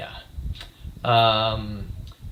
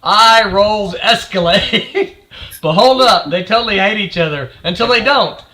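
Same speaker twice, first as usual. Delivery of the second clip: put-on bored, eye-rolling teen read; snarky teenager; 5 semitones higher, 5 dB louder, slower than usual. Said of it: "in a voice of a snarky teenager".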